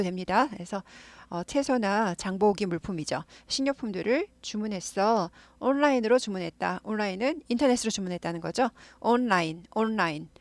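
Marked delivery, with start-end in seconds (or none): none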